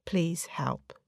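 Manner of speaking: breathy unvoiced whisper, very soft; whispered plea. Real, not acted. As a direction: barely breathe the words out, begging. End of letter, quiet plea.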